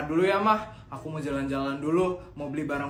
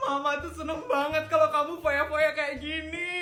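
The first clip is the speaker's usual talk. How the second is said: high, hammed-up sobbing pride; mock tearful joy; 11 semitones higher than usual. Proud mom crying over her spendthrift son, high mock sobs. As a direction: cry happy tears like a proud mother.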